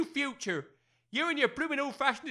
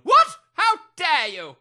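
high, loud shocked cry then slow fury; outraged shout; 5 semitones higher, 11 dB louder, slower than usual. Acted. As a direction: bellow in affronted disbelief. Major insulted, explodes.

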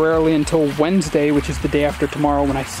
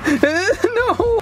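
high scared yelp. Real, not acted. Turtle lunges.

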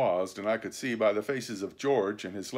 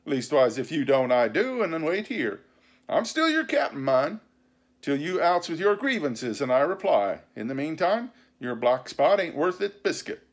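raised pitch, commanding sea dog; scornful pirate captain; 6 semitones higher, 4 dB louder than usual. A narrator's reading. Captain telling the mutineer to wait, scornfully firm. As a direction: put the upstart in his place. Silver asserts his captaincy.

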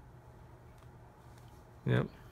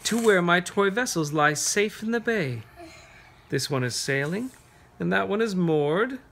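higher, sing-song verse building to the sailors; reading a poem to children; 5 semitones higher, 5 dB louder than usual. Acted. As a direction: paint the harbor for the kids.

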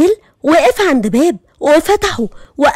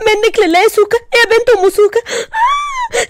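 high shrieking panic ending in a scream; panicked scream; 6 semitones higher than usual. Acted. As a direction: scream in terror at the genie. Genie appears, friend panics.

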